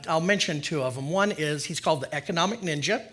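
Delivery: ordinary delivery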